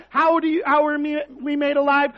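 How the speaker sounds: ordinary delivery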